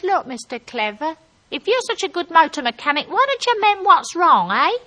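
loud, high comeback; shouted retort. Acted. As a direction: call the challenge down, climbing as you go.

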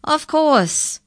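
high, loud exclamation; crying out. A narrator's reading. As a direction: exclaim in sudden realisation. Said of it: "cried the first incisor".

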